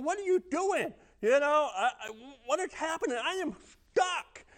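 high-pitched, exasperated comic alarm; retold bewildered pleading; mock panic. Imagined protest while choking.

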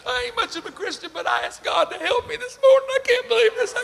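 very high, weepy retelling; hammed-up tearful disbelief; imitated crying. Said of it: "He cried".

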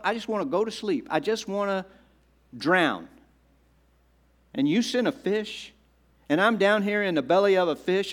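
incredulous Jonah. Acted. Jonah complains to God from the fish, incredulous. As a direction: act out Jonah fed up and dumbfounded with God. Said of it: "how do you think Jonah is emotionally reacting to this".

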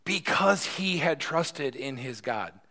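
slow, deliberate, every word weighted; slow emphasis. Shown as a demonstration. Preacher answering why to the congregation, slowly.

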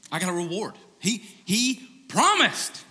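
put-on the kid's indignant claim; insistent kid. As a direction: lean hard on the promise.

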